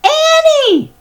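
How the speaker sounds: very high, loud cry; alarmed shout. A narrator's reading. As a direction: call out in panic. Jack calling back.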